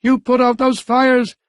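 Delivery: mayor losing his temper; screamed. A narrator's reading. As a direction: scream it at the subordinate. Mayor still screaming orders.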